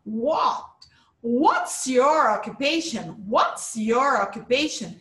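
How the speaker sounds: teacher slowly modelling front stress on "What's"; stress at the beginning. Shown as a demonstration. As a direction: punch the opening "What", stretch the question out. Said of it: "A intonation vai no comecinho".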